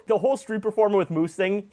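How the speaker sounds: usual speaking voice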